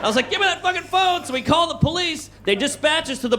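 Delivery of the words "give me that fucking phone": high-pitched mock desperation; acted panicky demand; frantic demand. Teen grabs for friend's emergency phone, mock frantic demand.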